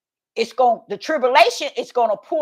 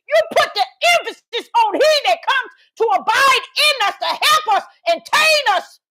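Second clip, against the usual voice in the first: preaching at full force; 9 semitones higher than usual. Acted. Preacher rising to a shout about Torah, full force. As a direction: drive the point home to the congregation.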